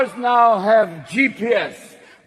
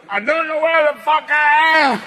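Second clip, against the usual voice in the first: put-on confused drunk GPS; 6 semitones higher than usual. The car's GPS complaining about its drunk driver, higher and panicky. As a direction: sputter like a lost, tipsy machine. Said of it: "Even the G P S in the car is going".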